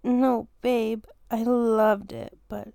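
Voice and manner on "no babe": ordinary talk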